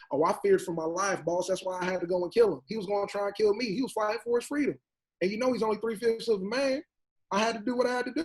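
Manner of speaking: a mocking put-on Southern voice; mock slave catcher accent. Slave catcher mocked to a panel in a put-on accent. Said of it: "You ain't have to do that accent".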